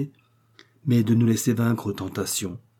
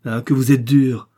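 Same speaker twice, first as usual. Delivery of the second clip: angry outburst; 2 semitones higher, 7 dB louder than usual. A narrator's reading. Young woman turns on Maxime for his harshness, angry cry. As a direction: fling the reproach at him. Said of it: "Elle quitta ma main avec colère".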